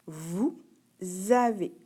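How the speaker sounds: "vous avez" slowly, with a z starting avez; liaison. Shown as a demonstration. Teacher doing the same for the pupil, "vous avez" with liaison. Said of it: "J'ai fait une liaison".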